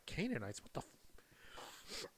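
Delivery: whispered, barely voiced; mouthed whisper. A podcaster mimicking the Rock mouthing his disbelief in a whisper. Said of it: "He mouths the word like".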